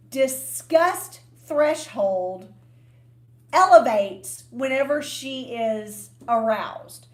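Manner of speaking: disgust threshold said slowly, word by word; slow, careful enunciation. Real, not acted. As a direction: take your time over a tricky mouthful. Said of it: "I have to say this slowly".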